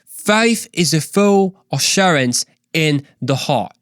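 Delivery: slow Australian accent, each word drawn out. Shown as a demonstration. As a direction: drawl it in an Aussie accent. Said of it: "That's how you say it".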